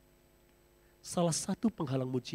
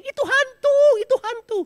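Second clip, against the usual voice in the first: panicked screaming; 15 dB louder than usual. Acted. Disciples scream in panic at a ghost on the water. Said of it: "itu murid-muridnya bilang".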